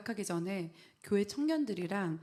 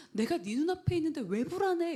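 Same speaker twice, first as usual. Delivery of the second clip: higher, louder, exasperated scolding; annoyed mother, retold; 9 semitones higher, 6 dB louder than usual. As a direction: snap at the child impatiently. Past irritated mother to anxious child.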